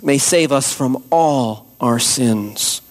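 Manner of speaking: ordinary talk, nothing marked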